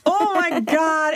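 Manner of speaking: high awed exclamation; stunned joy. Retold or imitated. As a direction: let the relief burst out slowly. Reaction to passing the exam.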